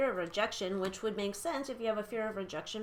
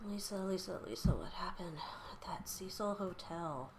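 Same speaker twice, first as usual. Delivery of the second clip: whispered calling; 7 dB quieter than usual. Real, not acted. Reader calling Elisa's spirit in a fading whisper. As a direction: whisper her name, fading softer each time.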